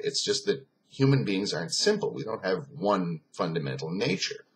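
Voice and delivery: ordinary delivery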